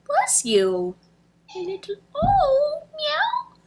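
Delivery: cooing to the cat. Real, not acted. Talking to her cat, very high sweet cooing. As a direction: coo up high at the kitty.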